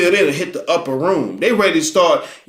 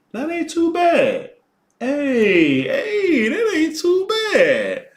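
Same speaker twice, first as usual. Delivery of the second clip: higher, slow pleased singsong; 9 semitones higher, much slower than usual. Real, not acted. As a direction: sing it out, pleasantly surprised.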